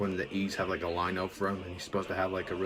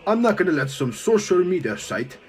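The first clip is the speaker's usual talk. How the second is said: furious yell; 8 semitones higher, 8 dB louder, slower than usual. Acted. Han yells in rage.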